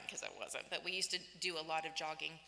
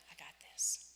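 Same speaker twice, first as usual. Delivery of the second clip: calm whisper; 3 semitones lower than usual. Retold or imitated. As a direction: hush it like a secret promise. God reassures the worried couple.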